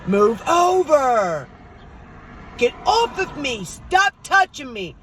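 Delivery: high, loud, drawn-out angry yell; dad screaming. Retold or imitated. Dad yelling at an unseen presence at night, imitated scream. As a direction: imitate a dad hollering at someone in bed. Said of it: "you hear my dad screaming".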